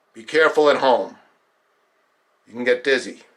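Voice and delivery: usual speaking voice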